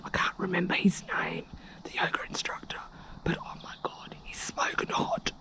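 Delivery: secretive gossipy whisper; conspiratorial whisper. Real, not acted. Vlogger confiding to camera about the instructor, whispering. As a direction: whisper it so nobody nearby hears.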